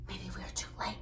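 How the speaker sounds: hushed, unvoiced whisper. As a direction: breathe it out in fear. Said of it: "whispered Bear".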